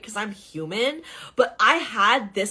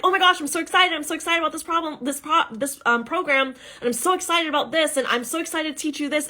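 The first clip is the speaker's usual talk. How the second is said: higher and fast, gushing over-the-top excitement; breathless hyped-up sales pitch; mock overexcited; 8 semitones higher, faster than usual. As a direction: gush like an overhyped seller. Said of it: "I'm not just like".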